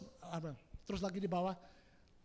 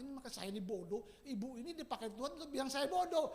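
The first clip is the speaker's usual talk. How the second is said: usual speaking voice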